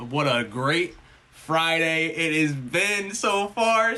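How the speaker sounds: very slow, showy delivery; drawn-out. Pretend. Streamer setting up a joke for chat, exaggeratedly drawn-out. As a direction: stretch the words out, building as you go.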